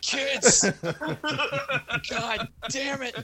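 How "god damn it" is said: high laughing mock curse; mock exasperation. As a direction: grumble it, half laughing. Mock frustration at kids.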